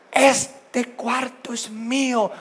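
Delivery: high, slow, stretched-out claim; mock a child staking a claim; squabbling child. Child claims the bedroom from a sibling, mock yelling. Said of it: "se gritan uno al otro y la expresión".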